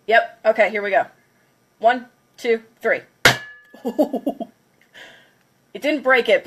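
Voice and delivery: ordinary delivery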